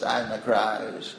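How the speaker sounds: slow, creaky vocal fry. Shown as a demonstration. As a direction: let the words creak out slowly at the bottom. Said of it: "is creaky voice".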